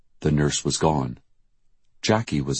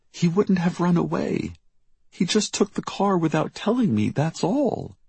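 high, fretful denial; anxious protest; 14 semitones higher than usual. A narrator's reading. Mother insisting her son didn't run away, anxious. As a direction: defend him anxiously in a high voice.

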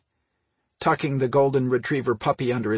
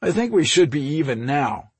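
raised, louder arguing tone; 6 semitones higher, 5 dB louder than usual. A narrator's reading. Brother pleading that they are even, arguing. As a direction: press the point. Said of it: "he argued".

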